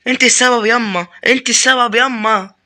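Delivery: high, loud hammed-up wail; mock wail. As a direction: wail it like a spoiled child. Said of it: "loud high wail to mother".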